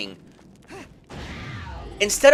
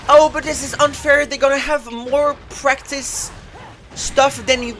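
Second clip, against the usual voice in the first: mocking quote; 7 semitones higher than usual. Mocking their argument.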